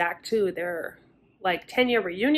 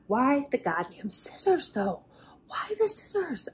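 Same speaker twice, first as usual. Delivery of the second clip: puzzled whisper to herself; hushed, bewildered aside; 4 semitones higher, 5 dB quieter than usual. Real, not acted. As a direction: breathe the question out under your breath, baffled. Puzzling over a plot detail.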